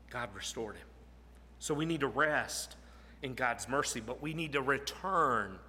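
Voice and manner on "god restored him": quiet emphasis. Shown as a demonstration.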